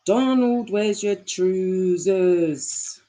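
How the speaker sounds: hammed-up Scottish accent, very slow and stretched. Second try for the chat at a drawn-out Scottish accent. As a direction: draw it right out in broad mock Scottish. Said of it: "good Scottish accent? Let me try".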